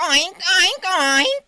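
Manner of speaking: playful oinking; mock pig noises; pig oinks. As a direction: oink it like a little pig. Mimics pig at party.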